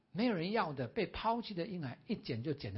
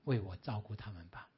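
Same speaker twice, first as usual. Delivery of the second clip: lower, soft and kind request; gentle voice of God; 7 semitones lower, 3 dB quieter than usual. Acted. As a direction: ask him gently, like a loving father. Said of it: "主就很温和地要说". (神温和低声地恳请牧师)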